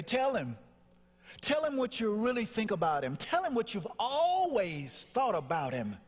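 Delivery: high, emphatic inner voice; insistent urging. Retold or imitated. Inner voice pushes son to tell his father, insistent. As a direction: urge him on, pitched high.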